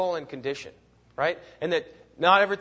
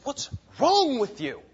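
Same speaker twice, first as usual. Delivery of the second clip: slow, raised rebuke; 8 semitones higher, slower than usual. Retold or imitated. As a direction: drag out the scolding question, pitched up. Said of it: "he rebukes them".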